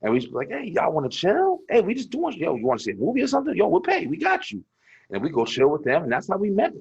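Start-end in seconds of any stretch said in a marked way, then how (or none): 0.5-4.6 high, coaxing replay of an invite; acted pickup invitation. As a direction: pitch the hangout in a lifted voice. Acting out inviting girls to hang out.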